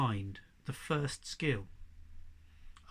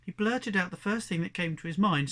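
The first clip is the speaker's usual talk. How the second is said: ordinary delivery, nothing marked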